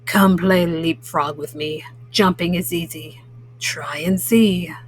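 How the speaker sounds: low, grumbling toad voice, sinking to a whisper at the end; grumpy toad. Acted. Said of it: "Grumpy Toad, who said".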